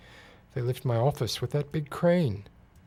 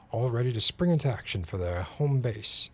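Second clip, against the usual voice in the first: ordinary delivery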